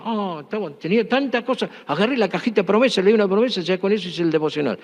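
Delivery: high-pitched mock excuse from a church member; imitating a sheepish excuse. Church member excusing skimpy devotional reading to the preacher, mock-defensive. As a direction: play the excuse-maker, voice pitched well up.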